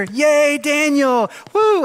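class cheering. Retold or imitated. Sunday school kids cheering for Daniel, loud excited whoop. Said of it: "everybody would cheer".

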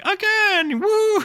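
very high, loud cheer; a thrilled kid wanting another go; excited little girl. Retold or imitated. Seven-year-old girl cheering.

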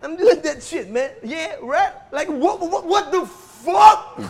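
loud laughing movie take; overacted action star shouting; Hollywood actor. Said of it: "And action".

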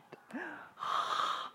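startled breathless gasp; sharp gasp. Real, not acted. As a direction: gasp in shock. Gasp at scare.

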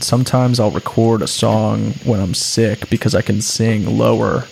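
ordinary talk, nothing marked

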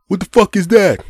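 high, loud startled yell; imitated aggressive outburst; cursing driver. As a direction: demand it like an angry driver. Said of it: "begins cursing at me".

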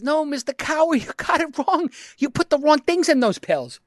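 put-on high-pitched, pleading kid voice; mock pleading kid. Kid telling drunk pharmacist the pills are wrong, acted high plea.

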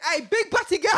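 high, loud call through laughter; mock catcall. Friend retells the man's heckle, laughing mock catcall. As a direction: call after her, imitating the heckler. Imitating a man calling after her.

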